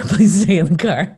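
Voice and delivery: pleading through a laugh; laughing plea. Retold or imitated. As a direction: beg while laughing. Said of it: "I was praying".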